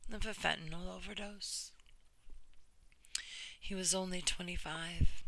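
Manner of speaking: earnest, measured reading of a personal essay; reading a story aloud. Acted. Reading a friend's brave story to viewers, earnest narration. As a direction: read it like you're giving her story a voice.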